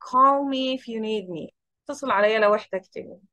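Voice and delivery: ordinary talk, nothing marked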